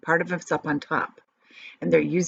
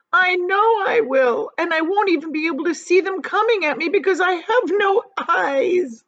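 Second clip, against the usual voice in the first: wailing; 11 semitones higher, 5 dB louder than usual. A narrator's reading. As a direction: whine it in despair, high and pitiful. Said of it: "wailed the poor earthworm".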